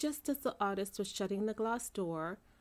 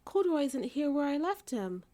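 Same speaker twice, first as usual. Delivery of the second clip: upset; 6 semitones higher, 5 dB louder than usual. A narrator's reading. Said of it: "she exclaimed".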